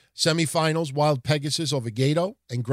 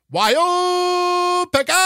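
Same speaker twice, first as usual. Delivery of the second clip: much higher, louder, slow drawn-out name call; Japanese announcer call; 14 semitones higher, 6 dB louder, much slower than usual. Retold or imitated. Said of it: "it would always be".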